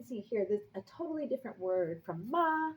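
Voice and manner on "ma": "ma" high and level; first tone. Shown as a demonstration. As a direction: hold "ma" up on a steady note. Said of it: "which is mom".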